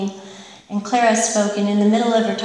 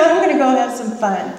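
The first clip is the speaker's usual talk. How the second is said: gleeful; 4 semitones higher, 5 dB louder than usual. Retold or imitated. Retold resolve to have fun.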